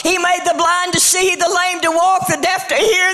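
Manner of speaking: high, rising preacher's cadence building to a shout; rousing church sermon climax; full-force preaching. Acted. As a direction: preach it, climbing with each line. Preaching the miracles list.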